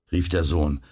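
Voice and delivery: usual speaking voice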